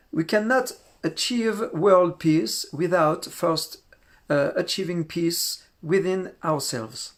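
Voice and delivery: slow, careful English read with a French accent. Real, not acted. Reading a lama's quote to the group, slow French-accented English. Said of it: "Excusez mon accent".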